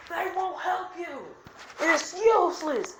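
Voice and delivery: high-pitched, forceful anime villain; villain's taunt. Acted. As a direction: call it out to crush their hope. Acted villain taunting in battle.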